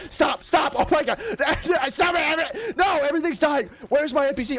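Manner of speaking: high, loud panicked yelling; panicked shouting. Real, not acted. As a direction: yell in real panic as everything dies. Mobs killing his NPC.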